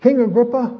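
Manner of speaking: a sharp, high "King Agrippa" hailing the king; sudden loud call. Retold or imitated. Paul suddenly calling out to the king, loud and high. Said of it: "Paul's sudden question".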